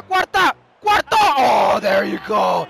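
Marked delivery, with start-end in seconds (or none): 0.0-1.2 high-pitched triumphant call; elated raised voice; excited goal shout. Real, not acted. Celebrating fourth goal.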